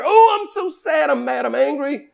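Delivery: high mock shout of sad fury; hammed-up raging wail, fading at the end; raging grief. Preacher showing grief's raging expression, mock angry shout. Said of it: "just a raging anger".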